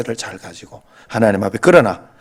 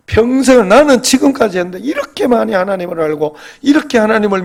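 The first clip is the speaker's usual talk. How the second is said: raised, high-pitched preaching voice; fervent preaching; 7 semitones higher, 3 dB louder than usual. Real, not acted. Pastor urging believers to trust God completely, fervent preaching. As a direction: preach it with rising urgency.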